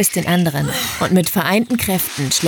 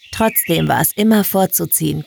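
usual speaking voice

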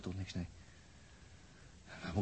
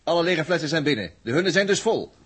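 loud call; 7 semitones higher, 9 dB louder than usual. Acted. Announcing loudly across the room.

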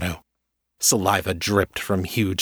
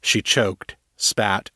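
ordinary delivery, nothing marked

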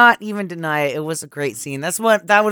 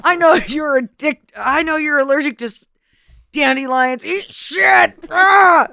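put-on high, loud kid shouting at a rival; childish yelling taunt; 8 semitones higher, 5 dB louder, slower than usual. Voicing her childhood self yelling at a classmate, loud and high. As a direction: scream it like a furious schoolkid. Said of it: "It was like".

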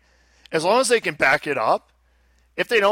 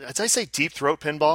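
ordinary talk, nothing marked

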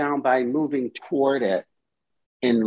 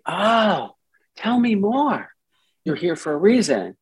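curious welcome; 6 semitones higher than usual. Shown as a demonstration. Therapist welcoming part.

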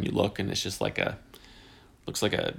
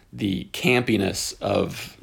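ordinary talk

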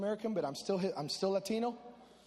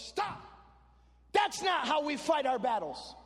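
stern dad; 6 semitones higher than usual. Retold or imitated. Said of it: "my dad stops the service".

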